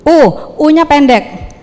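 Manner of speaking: ordinary talk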